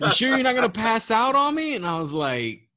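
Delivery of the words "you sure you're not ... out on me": worried artist. Retold or imitated. Tattoo artist voiced in story.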